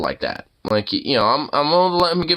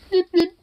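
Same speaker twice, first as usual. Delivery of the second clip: much higher squeaky beep beep; playful beep beep. Retold or imitated. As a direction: chirp it like a cute toy. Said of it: "We're just like".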